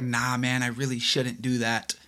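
soft, low inner voice; little inner voice. Retold or imitated. Voicing the inner voice for his cohost, soft and low. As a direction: hush it like a voice in your head. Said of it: "this little voice like".

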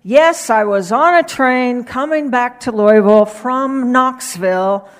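loud, emphatic repeat of the line. Retold or imitated. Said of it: "he said in a louder voice".

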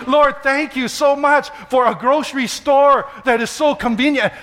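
mock-fervent thanks while stuck in line; exuberant thanks prayer. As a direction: pray giddily for silly everyday blessings. Playful prayer in grocery line.

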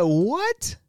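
a jolt of disbelief; high, startled question; shocked what. Retold or imitated. Hearing mom is being sued.

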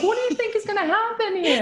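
high-pitched incredulous challenge; exasperated questioning. Retold or imitated. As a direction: plead for common sense, exasperated. Retold exasperated question to friend.

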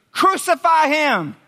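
loud, high, drawn-out shout; acted mob demand; crowd cry. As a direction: yell it slowly like a mob passing sentence.

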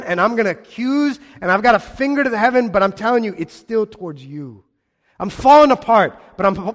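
loud, high, defiant lament; voicing Heman. Preacher voicing Heman's defiant lament toward God. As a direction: cry it out like a man falling apart. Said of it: "And Heman's going".

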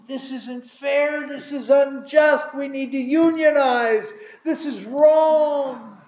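high, slow, hammed-up whiny complaint; mock the grumblers' protest; whining workers. Preacher mimicking workers grumbling at unfair pay, whiny.

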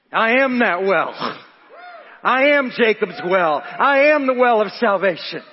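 can't hold it in, proclaim it; bursting out. Acted. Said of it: "He absolutely couldn't contain himself".

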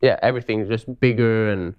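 ordinary talk

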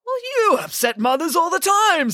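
high-pitched, aggrieved comeback; indignant retort. Acted. Hades argues back with Zeus.

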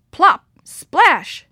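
loud, high plop and splash; splashing sound words. A narrator's reading. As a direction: punch out each sound word. Narrator voices sudden water sounds.

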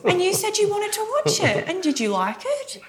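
very high, insistent retort; indignant protest. Real, not acted. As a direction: throw it back at them, high-pitched and pointed. Bickering over who wanted the film.

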